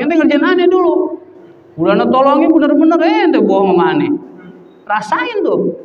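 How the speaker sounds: high-pitched acted reproach; indignant scolding. Villagers refuse to help the lying herder, indignant scolding. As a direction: scold the prankster, starting high and easing down.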